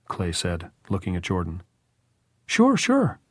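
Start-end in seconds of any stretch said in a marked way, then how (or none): 2.5-3.2 put-on very high, impatient boy; impatient cry. A narrator's reading. Jordan answering Clay about resting, impatient. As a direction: brush it off in a high-pitched cry. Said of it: "Jordan cried impatiently".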